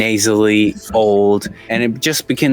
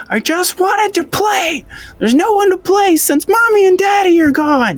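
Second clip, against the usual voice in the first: put-on high wailing child's voice; crying little girl; 3 dB louder than usual. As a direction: wail like a sobbing little girl. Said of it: "She's crying, bawling, throwing a tantrum".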